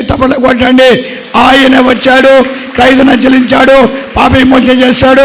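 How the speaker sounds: loud, ringing call to the crowd; fiery altar-call shout; shouted preaching. Acted. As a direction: proclaim it to the back row.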